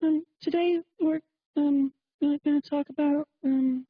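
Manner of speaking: high put-on voice, halting with ums; mocking female radio host. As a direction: imitate a clueless woman host, stalling between phrases. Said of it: "she talked like this".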